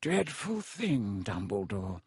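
slow, languid disdain, falling away; lazy. A narrator's reading. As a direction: draw it out lazily. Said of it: "said Malfoy lazily".

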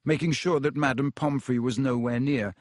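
plain reading voice

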